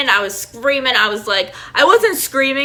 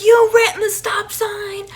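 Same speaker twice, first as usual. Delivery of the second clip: very high, drawn-out mock wail; imitated upset anger; 11 semitones higher, slower than usual. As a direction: imitate an upset driver's high-pitched accusation. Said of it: "She was so mad".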